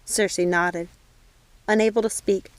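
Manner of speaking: ordinary delivery, nothing marked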